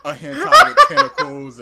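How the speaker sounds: high and loud through laughter; embarrassed laughing reveal of the anime. Real, not acted. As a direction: blurt it out cracking up.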